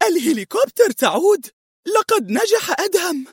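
very high, elated calling out; excited cry. A narrator's reading. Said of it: "أشارت منى إلى السماء صائحة". (صيحة فرح بصوت عالٍ رفيع)

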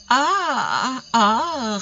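teasingly withheld answer on open vowels; fixed, evasive answer. A narrator's reading. Daughter asked where she met him, fixedly. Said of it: "répondit Emelina fixement".

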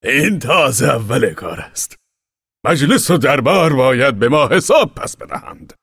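high, loud rallying shout from a commander. Acted. Commander rallies the exiles, loud high shout. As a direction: bellow it to the crowd, first words slow.

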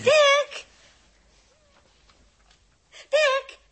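calling out. Acted. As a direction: call his name so it carries. Calling him from another room.

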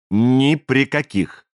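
"Ни при каких" drawn out at half pace; slow, weighty stress on each word of "Ни при каких"; emphatic repetition. A narrator's reading. Stranger binds brothers to a promise, "Ни при каких" slow and emphatic.

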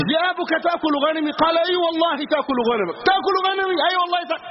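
two fools bickering in high, rising voices; hammed-up argument climbing in pitch; mock quarrel. Two fools quarrel over imagined sheep.

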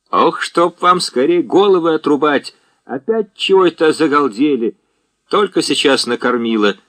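сердито прикрикнуть на гусей; громкое ворчание бабушки; ворчливый крик. A narrator's reading. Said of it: "крикнула Франтишка Игнатьевна". (ворчливо крикнуть)

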